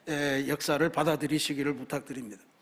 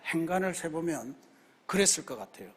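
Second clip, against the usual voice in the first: ordinary talk, nothing marked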